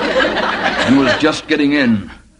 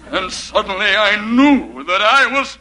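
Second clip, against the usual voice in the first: milk the reveal like a horror film; horror climax cry. Acted.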